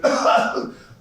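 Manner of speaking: throaty, hacking gagging noise; mock choking. Friend at dinner with his wife, mock choking. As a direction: act out choking on food. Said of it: "if he starts choking on anything".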